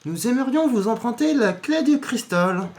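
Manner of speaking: high, put-on sleazy voice; squeaky gross character voice; silly high voice. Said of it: "tu lui as fait une voix dégueulasse". (voix aiguë et dégoûtante)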